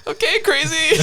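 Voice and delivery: high giggled dismissal; amused, half-laughing brush-off; laughing mock reply.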